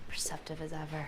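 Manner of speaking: dry whispered jab; sarcastic whisper. Acted. Sarcastic aside, unvoiced.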